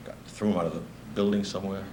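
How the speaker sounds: usual speaking voice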